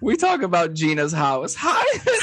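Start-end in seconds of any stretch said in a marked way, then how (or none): 1.5-2.2 very high squealed refrain; mock shriek. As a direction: wail it up in falsetto. Both sing refrain, very high.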